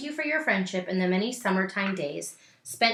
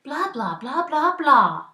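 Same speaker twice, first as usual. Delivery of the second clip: chattering aunt; 7 semitones higher, 7 dB louder, slower than usual. Acted. Aunt Pearl's chatter voiced.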